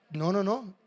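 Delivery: ordinary delivery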